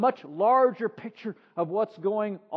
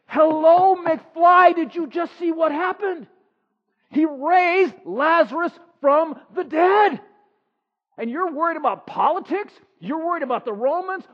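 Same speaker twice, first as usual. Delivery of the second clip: higher, louder exasperated hello McFly; mock exasperation; 7 semitones higher, 7 dB louder than usual. Real, not acted. Preacher mocking the leaders, exasperated and higher. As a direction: wake them up, incredulous.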